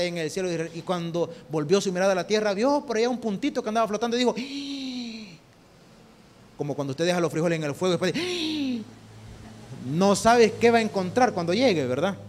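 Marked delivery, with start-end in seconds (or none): 4.4-5.4 sudden high mock gasp of surprise; surprised gasp. Dios ve a Noé y se sorprende. 8.2-8.8 high mock gasp, caught off guard; surprised gasp. Frijoles olvidados en el fuego.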